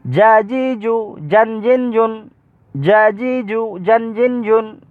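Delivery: syllables run together on a high note; quick drill recitation. Shown as a demonstration. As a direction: chant each set in one quick go. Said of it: "একটু তাড়াতাড়ি পড়ার চেষ্টা করবেন".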